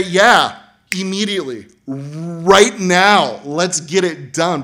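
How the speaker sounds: loud and urgent, slowing at the end; eager yes. Acted. Frog-plagued listener answering Moses, loud and urgent. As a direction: insist it be done now.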